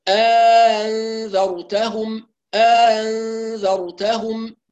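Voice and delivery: very slow chanted أنذرتهم with eased hamza; ترتيل بطيء; Qalun recitation with tashil. Shown as a demonstration. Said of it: "نقول".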